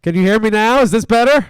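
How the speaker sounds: loud bullhorn voice; blaring through a bullhorn; through a megaphone. Real, not acted. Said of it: "Yeah, unfortunately I can".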